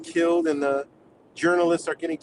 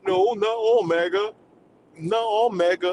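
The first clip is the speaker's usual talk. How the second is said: higher, put-on defensive whine; mock MAGA voice; 5 semitones higher than usual. Mocking MAGA replies to his posts, in a higher put-on voice. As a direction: imitate their protest in a squeaky put-on voice. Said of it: "they're like".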